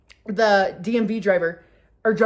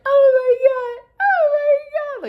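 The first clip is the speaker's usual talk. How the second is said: loud, slow high wail; laughing so hard; 8 dB louder, slower than usual. Retold or imitated. Daughter with tears streaming down, wailing hysterically. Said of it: "just laughing so hard".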